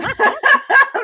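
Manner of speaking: loud high cackle. Real, not acted. To the host, cracking up over her bad accent. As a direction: crack up at yourself.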